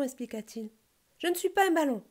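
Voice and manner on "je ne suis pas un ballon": high, loud objection; indignant protest. A narrator's reading. Squirrel mistaken for a balloon, indignant protest. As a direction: protest loudly, pitched right up. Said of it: "protesta l'écureuil".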